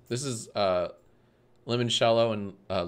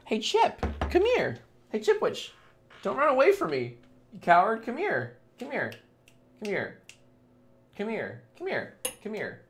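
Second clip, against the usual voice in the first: very high, squeaky coaxing; high pet-calling voice; 10 semitones higher, 3 dB quieter than usual. Real, not acted. Streamer to pet running away, high coaxing call. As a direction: call a runaway pet in falsetto, growing softer.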